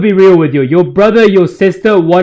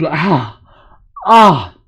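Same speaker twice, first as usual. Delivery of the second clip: sore throat; 2 semitones lower than usual. Real, not acted. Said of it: "my throat is in so much pain".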